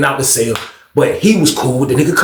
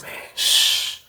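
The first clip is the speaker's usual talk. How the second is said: hushing; 10 dB quieter than usual. Retold or imitated. Hushing the other man.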